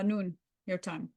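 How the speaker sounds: normal talking voice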